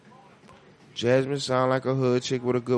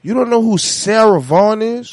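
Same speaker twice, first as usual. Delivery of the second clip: incredulous; 7 semitones higher, 7 dB louder than usual. Real, not acted. Incredulous at friend.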